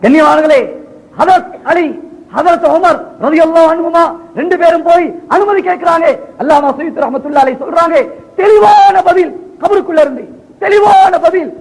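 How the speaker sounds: high, ringing sermon delivery; climactic preaching. Acted. Preacher telling congregation of the answer from the grave, climactic. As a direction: build to the miracle in a high declaiming voice.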